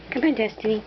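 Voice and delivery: normal talking voice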